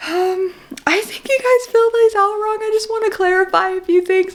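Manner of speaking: high-pitched imitation of a sweet caller; sweet agency lady. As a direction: politely break it to them in a high voice. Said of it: "this sweet lady called us and was like".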